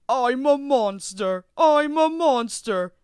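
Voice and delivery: slow, loud, high playful monster roar; mock monster shout. A narrator's reading. Prankster engine scaring Percy in a slow loud monster voice. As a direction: ham up a scary monster. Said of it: "James shouted".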